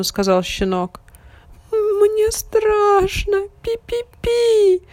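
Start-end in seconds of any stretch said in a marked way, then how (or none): 1.7-4.8 high, slow scared mouse squeak; frightened squeak. A narrator's reading. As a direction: squeak it out trembling with fear. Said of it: "запищал мышонок".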